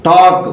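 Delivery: "టాక్" with the long vowel straight into K; silent L. Shown as a demonstration. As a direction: say "టాక్" with no L before the K. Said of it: "ఇక్కడ L పలకలేదు".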